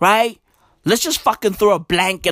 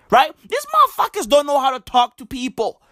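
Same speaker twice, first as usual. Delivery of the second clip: ordinary delivery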